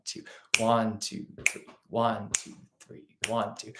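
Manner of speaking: soft count in groups of three, one stressed; grouped counting. Shown as a demonstration. Teacher counting groupings of three for the class, softly. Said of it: "start out with one of those groupings of three".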